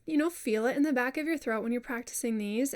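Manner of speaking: normal talking voice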